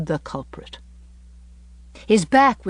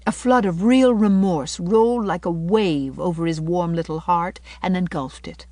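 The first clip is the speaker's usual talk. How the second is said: plain reading voice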